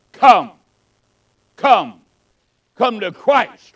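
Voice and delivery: loud, urgent call to come forward; pleading altar call. Real, not acted. Preacher calling the congregation forward, loud and urgent. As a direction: call the sinners forward with force.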